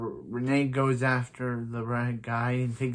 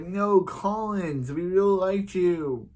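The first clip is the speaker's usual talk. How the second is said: high, drawn-out, louder mock wail; mock lament; 7 semitones higher, 4 dB louder, slower than usual. Lamenting a character killed off after one episode, hammed-up wail. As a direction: wail it slowly in hammed-up dismay.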